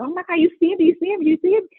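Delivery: high, loud excited squeal; mother cheering her son's first NBA game to husband, high and loud; retold excited scream. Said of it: "I'm like".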